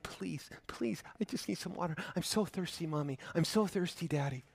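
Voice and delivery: put-on soft whispered child's plea; pleading child. Thirsty child begs mom and dad, whispering. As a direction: beg weakly like a parched little kid. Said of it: "asking mom and dad".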